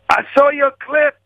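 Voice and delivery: very high, strained impression; slow, squeezed falsetto compliment; Richard Pryor. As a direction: imitate an ailing comic in a thin high voice. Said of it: "He goes".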